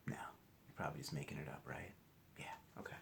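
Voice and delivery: whispered teasing aside. Real, not acted. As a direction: drop to a whisper, playful.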